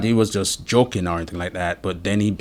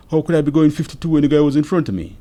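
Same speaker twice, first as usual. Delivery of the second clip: raised, high-pitched objection; retold incredulous protest; 8 semitones higher, 6 dB louder, faster than usual. Driver disputes speeding claim to officer, incredulous objection. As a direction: throw the accusation back as an impossible claim.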